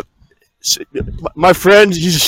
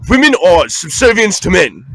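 Andrew Tate impression; 4 semitones higher than usual. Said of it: "too British".

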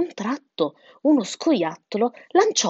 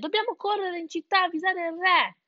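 high, urgent cry; yelling chick; 11 semitones higher than usual. A narrator's reading. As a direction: urge everyone on in a raised voice. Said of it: "urlò Sansone". (pulcino concitato, voce acuta)

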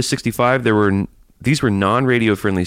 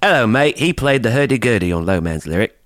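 hammy Aussie greeting and boast; playful bogan Australian; mock Australian accent; 2 semitones higher than usual. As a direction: say it like a cartoon Australian. Joins the Australian accent bit.

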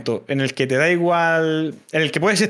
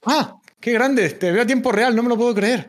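mock delight; 8 semitones higher than usual. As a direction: overplay the thrill at the camera. Estoy a punto de echarme a llorar.

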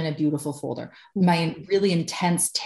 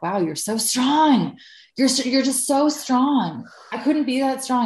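high, drawn-out mock awe; gushing admiration; 8 semitones higher than usual. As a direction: imitate friends gushing with amazement. Voicing admiring friends, high and drawn out.

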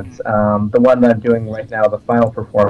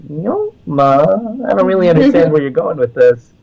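puzzled inflection; 8 semitones higher, 3 dB louder than usual. Retold or imitated. Fan imitates the stage character's inflection, puzzled and high. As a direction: imitate a character questioning in a raised, wondering voice. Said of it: "there's a lot of that in her inflection".

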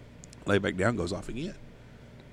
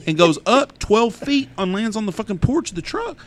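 amazed telling; 11 semitones higher, 5 dB louder than usual. Real, not acted. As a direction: savour the crash in disbelief. Wreck climax told with amazement.

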